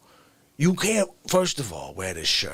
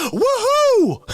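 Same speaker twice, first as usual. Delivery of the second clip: high, loud woohoo; a big excited cheer from across the room; whooping fan; 8 dB louder than usual. Retold or imitated. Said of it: "somebody other side of the restaurant goes".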